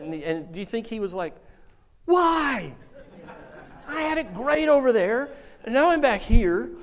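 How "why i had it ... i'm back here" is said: high, laughing indignant voice; grumpy Lazarus. Acted. Lazarus back from paradise, laughing indignant complaint. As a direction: complain comically at being back.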